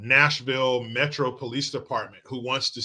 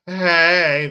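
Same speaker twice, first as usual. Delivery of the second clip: mock coming-out greeting; 6 semitones higher, 10 dB louder than usual.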